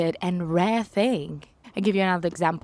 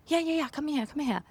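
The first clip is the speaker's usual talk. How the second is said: higher, softer beckoning call; insistent call; 9 semitones higher, 5 dB quieter, faster than usual. Retold or imitated. Construction worker beckoning her out of the rain, higher. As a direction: wave her over, higher.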